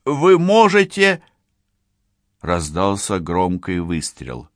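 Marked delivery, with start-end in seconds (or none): 0.0-1.2 slow, high, loud call; shouting. A narrator's reading. Policewoman negotiating with the criminal through a door, shouting. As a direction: project it slowly, pitched far up. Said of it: "крикнула Францискович преступнику".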